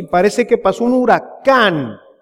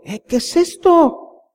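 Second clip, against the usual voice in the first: indignant surprise; 8 semitones higher, slower than usual. Retold or imitated. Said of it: "Y tú".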